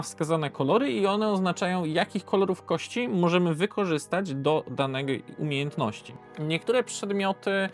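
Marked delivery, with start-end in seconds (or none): none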